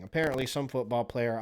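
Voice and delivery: usual speaking voice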